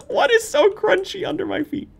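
alarmed squeaky question; scared, high-pitched. Real, not acted. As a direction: ask it in a frightened squeak. Startled by crunching noises.

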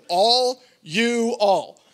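All you all stretched very slow; exasperated mock drawl; drawn-out mock Texan. Shown as a demonstration. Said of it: "makes my head wanna explode".

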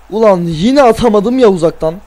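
ordinary talk

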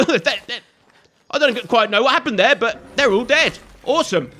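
normal talking voice

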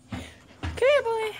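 high puppy-calling voice; calling the dog. Real, not acted.

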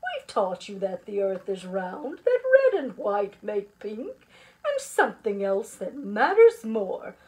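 loud, emphatic teacher voice; rally the class with conviction; hearty, assured pep talk; confident encouragement. Acted. Teacher rallies the worried class.

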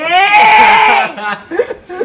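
gargalhada alta de vitória; triumphant laugh. Real, not acted. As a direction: riso vitorioso. Vence o desafio, ri alto. (gargalhada de vitória)

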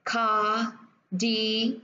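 cardiology very slowly, syllable by syllable; cardiology in separate syllables, the OL stressed; stress on third syllable. Shown as a demonstration. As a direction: stretch cardiology out, car-di-OL-o-gy. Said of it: "Những cái âm còn lại mà không được nhấn thì thường nó sẽ có xu hướng đi xuống".

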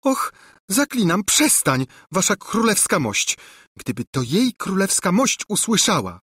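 high-pitched, anxious entreaty; acted plea, not truly whispered; pleading. A narrator's reading. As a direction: beg the king to stop. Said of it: "szepnęła błagalnie Ruzia".

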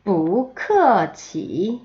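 뿌크어치 very slow, ending in a long rise; tone drill. Shown as a demonstration. Teacher modelling the whole phrase, 뿌크어치 slow and stretched. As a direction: model every syllable of 뿌크어치 slowly. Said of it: "세 번".

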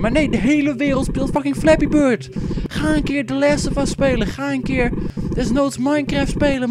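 high-pitched exasperated rant; worked-up gamer rant; ranting angrily. Real, not acted. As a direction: vent your frustration at the whole world. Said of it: "Daar word ik boos om".

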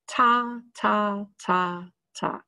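"Ta, ta, ta, ta" as short, separate tongue taps, fading at the end; tonguing syllables. Shown as a demonstration. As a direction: tap each "ta" along with the class. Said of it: "Can you do that with me?".

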